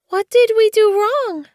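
very high, scared little kit voice; a tiny kit asking in fear; frightened kit. A narrator's reading. Frightened kit asks Blue Fur, very high and scared. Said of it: "bristling with terror".

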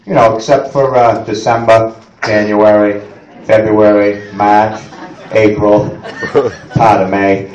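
low, quiet resigned local; low, resigned Mainer. Retold or imitated. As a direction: drone it like a Mainer consoling himself. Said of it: "This is what we say".

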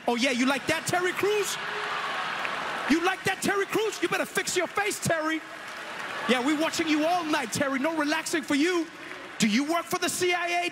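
high teasing taunt; punchy late night jokes. Acted. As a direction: needle him, playful menace. Taunting Terry Crews in crowd.

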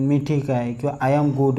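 ordinary talk

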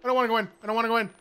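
scared pleading. Real, not acted. Refusing to enter dark room.

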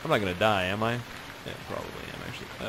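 normal talking voice

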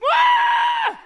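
loud, very high fake crying wail; mock baby wail. Embarrassed magician pretends to cry, wailing like a baby. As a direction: bawl like a baby, hammed-up. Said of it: "now I'm gonna cry like a baby".

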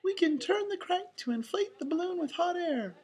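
very high, light falsetto; Minnie. A narrator's reading. Mouse offering her friend the answer, high falsetto. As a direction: answer brightly as a sweet cartoon mouse. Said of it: "answered Minnie".

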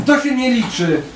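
lightly voiced complaint; complaining. A narrator's reading. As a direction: protest like a sulky little girl. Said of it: "narzekała dziewczynka".

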